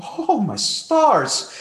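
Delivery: mock prim gasp, drawn out slowly; shocked polite churchgoer. Preacher mocking offended listeners, prim shocked voice. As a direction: play a scandalised prude clutching pearls. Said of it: "polite people who are thinking".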